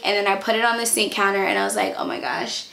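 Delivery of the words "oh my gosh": whispered. Retold or imitated. Seeing pregnancy test result.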